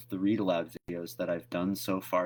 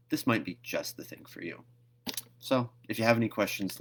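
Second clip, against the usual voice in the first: usual speaking voice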